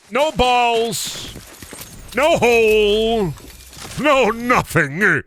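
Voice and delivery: high, slow squeaky wailing. Pretend. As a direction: wail it like a tiny cartoon pet.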